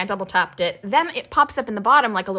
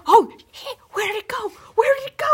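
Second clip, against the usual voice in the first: panicked squeal; 12 semitones higher than usual. Retold or imitated. Panicked retold self quote.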